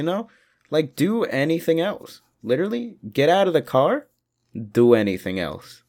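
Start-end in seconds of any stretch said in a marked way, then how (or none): none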